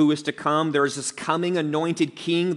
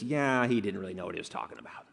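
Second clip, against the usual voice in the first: quick, low, softer aside; parenthetical aside; 6 semitones lower, 7 dB quieter, much faster than usual. Retold or imitated. Preacher voicing Luke's gracious note on Peter, parenthetical aside. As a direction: toss it off low and fast, as if in brackets. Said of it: "in parenthesis".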